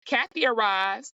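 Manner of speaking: usual speaking voice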